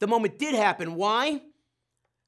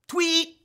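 high sharp mock whistle "tweet"; 8 semitones higher than usual. Coach blowing the whistle at practice, sharp mock whistle.

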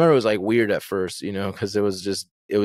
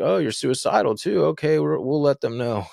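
higher-pitched, dismissive imitation of a cop; mocking jerk cop; 6 semitones higher than usual. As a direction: play the cop being a jerk about it. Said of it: "being a jerk".

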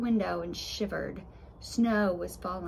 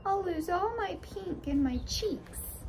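put-on high girly voice; 9 semitones higher than usual. Pretty Raspberry Tart voiced.

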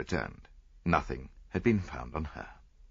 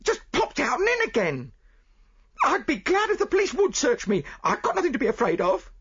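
high, loud defiant voice; indignant chambermaid; 5 dB louder than usual. Acted. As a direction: dare them to search you. Chambermaid defends herself.

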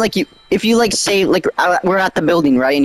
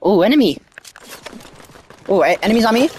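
combat callout; 6 semitones higher, slower than usual. Real, not acted. Enemies rush him mid-fight.

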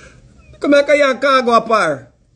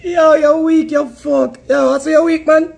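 normal talking voice

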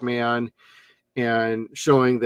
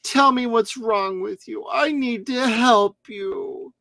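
mock pleading; 8 semitones higher than usual. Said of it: "voicing Julie and him".

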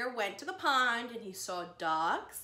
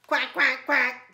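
louder mock quacking; duck voice; 11 dB louder, slower than usual. Duck answers Digger, playful loud quacking.

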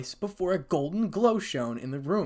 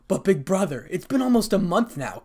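raised, higher protest; annoyed cry; 6 semitones higher, 5 dB louder than usual. A narrator's reading. Rias protesting to her big brother, annoyed. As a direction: complain insistently, a touch exasperated. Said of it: "cried Rias in annoyance".

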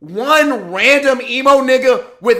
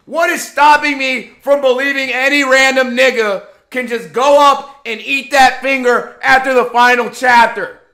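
normal talking voice

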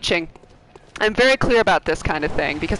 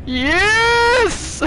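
high, drawn-out yes; thrilled squeal of success; real excited cheer; 13 semitones higher, 4 dB louder than usual. Real, not acted. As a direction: cry out in triumph, stretching the word. Delighted at co-op partner arriving.

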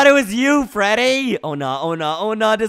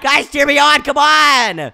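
high, loud excited appeal; rally the crowd at full voice; rallying shout; 7 semitones higher, 8 dB louder, slower than usual. Pretend. Fighter asking friends for cheers, rallying shout.

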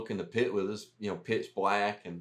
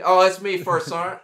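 sheepish loud confession; 7 semitones higher, 10 dB louder than usual. Retold or imitated. Owning up to the sergeant.

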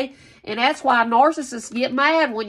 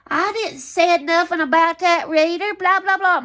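mocking rival; 7 semitones higher, 4 dB louder than usual. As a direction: sneer out her denial in a higher put-on voice. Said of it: "you can sit there and say".

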